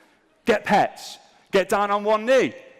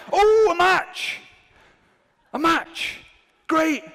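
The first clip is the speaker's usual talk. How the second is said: high, loud whoop of joy; mock elation; 12 semitones higher, 5 dB louder, much slower than usual. Dating-app user finally getting a match, hammed-up elation. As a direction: celebrate wildly, first word drawn out.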